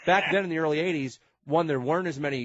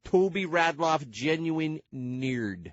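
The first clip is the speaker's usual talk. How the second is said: nerd voice; 3 semitones higher, much slower than usual. Pretend. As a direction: drag it out like the nerd Toby Radloff. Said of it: "Can you still do the nerd voice?".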